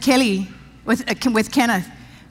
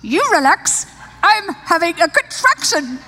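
snapping back; 6 semitones higher, slower than usual. Retold or imitated. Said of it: "I was like".

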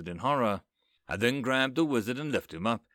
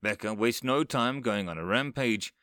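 ordinary delivery

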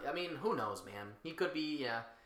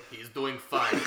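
put-on Russian accent; 2 semitones lower, 4 dB louder, slower than usual. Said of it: "he has a Russian accent".

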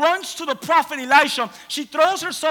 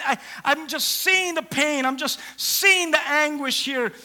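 ordinary talk, nothing marked

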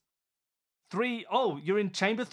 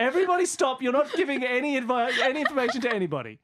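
exasperated laugh; 5 semitones higher, 3 dB louder, faster than usual. Real, not acted. Player still begging teammates to stop.

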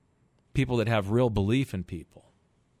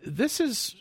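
self-realization exclaim; 11 semitones higher than usual. Retold or imitated. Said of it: "I just stop and I go".

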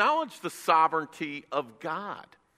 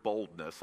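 boldness said low and weighty; emphasized word; 9 semitones lower, 8 dB quieter than usual. Shown as a demonstration. Preacher lingering on boldness. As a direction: lean on boldness. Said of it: "notice this next word".

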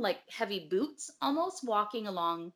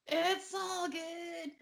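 high, drawn-out fake reassurance; mock cheerful singsong; 9 semitones higher, much slower than usual. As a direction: stretch it out in sugary denial.

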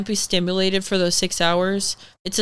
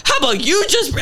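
high, heated comeback, cut short; retold angry retort; 8 semitones higher, 4 dB louder than usual. Patient retelling her retort to the nurse, mad. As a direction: fire back indignantly, pitch jumping up. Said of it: "I was so mad".

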